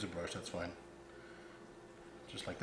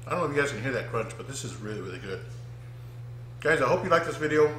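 talking through a mouthful of food; words round a bite of empanada; mouth full; 4 semitones higher, 5 dB louder than usual. Real, not acted. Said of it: "Sorry I'm talking with my mouth full".

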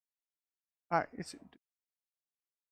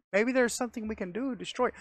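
ordinary talk, nothing marked

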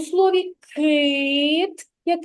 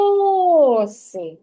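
calling out the word; 2 semitones higher, 3 dB louder than usual. Shown as a demonstration. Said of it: "ми гукаємо оси".

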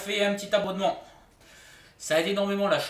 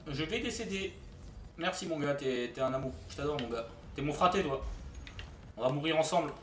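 normal talking voice

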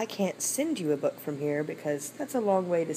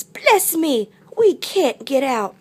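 higher, louder loris voice; sniffling loris; 9 semitones higher, 10 dB louder, much slower than usual. A narrator's reading. Loris fallen in hole, dismayed sniffly cry. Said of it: "Sniffled Loris".

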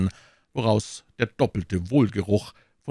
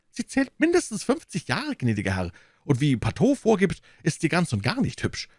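high, fretful innkeeper voice, wringing his hands; anxious innkeeper; 8 semitones higher than usual. Acted. Innkeeper pleading with D'Artagnan, high and anxious. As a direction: play the worried host complaining.

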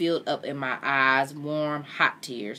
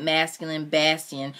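usual speaking voice

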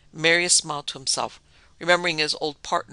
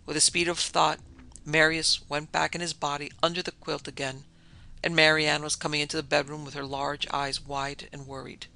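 plain reading voice

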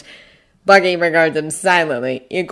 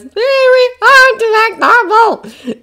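high, slow strained voice; drawn-out high strain; 6 dB louder, slower than usual. A narrator's reading. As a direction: push the voice up, straining to sound normal. Narrator mimics strained voice.

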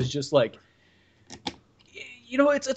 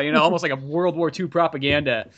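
ordinary talk, nothing marked

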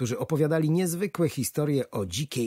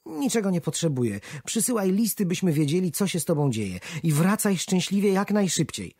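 higher, a touch louder caring wife; tender worry for her husband; wife seeing husband off with concern; 7 semitones higher, 3 dB louder than usual. A narrator's reading. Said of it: "z troską w głosie".

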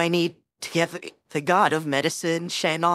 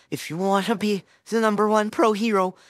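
ordinary talk

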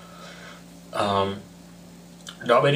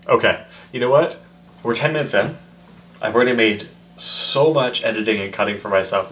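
ordinary talk

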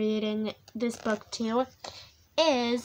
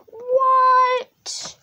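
high loud indignant what; outraged; 10 semitones higher, 6 dB louder than usual. Pretend. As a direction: blurt it in outrage. Outraged at silly rhyme.

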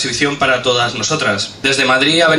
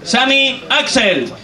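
high, slow, stretched name call; stage announcer; 6 semitones higher, slower than usual. Real, not acted. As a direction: draw out the contestant's name. Host calls next poet up.

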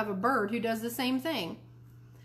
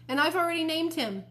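put-on high, louder eager kid; proud little boy; 8 semitones higher, 5 dB louder than usual. A narrator's reading. Fudge announcing he named his bird, proud.

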